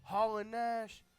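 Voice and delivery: high, loud cocky boss voice; Ric Flair impression. Flair tells Hall, Nash and Steiner who's in, loud and high. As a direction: lord it over them as the president. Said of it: "He says".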